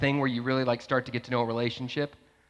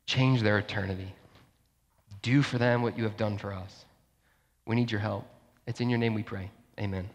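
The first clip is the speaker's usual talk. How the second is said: prayer; 4 semitones lower than usual. Real, not acted. Pastor asks God to change lives, prayerful and hushed.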